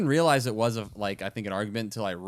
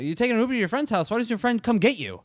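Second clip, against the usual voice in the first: higher, fast nagging-mom voice; quick, pitched-up mom impression; imitating mom; 11 semitones higher, faster than usual. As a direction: rattle off the questions like a suspicious mother. Voicing his mom's questioning.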